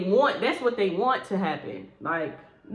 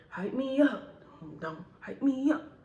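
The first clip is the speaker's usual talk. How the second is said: mocking echo; 4 semitones higher, 4 dB quieter than usual. Mocking repeat.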